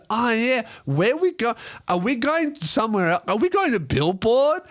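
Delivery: put-on high, dazed drunk girl questions; lost and hopeful, tipsy.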